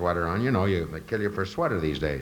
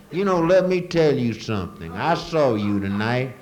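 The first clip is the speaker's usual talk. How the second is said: higher, slow, loud Georgia drawl; slow, loud Southern local bragging; 7 semitones higher, 6 dB louder than usual. Retold or imitated. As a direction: stretch it out like a proud Georgia local. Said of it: "I talked to another person who said".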